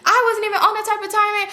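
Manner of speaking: high, pleading self-justification; defensive protest. Retold or imitated. Acted Rat defending herself to him.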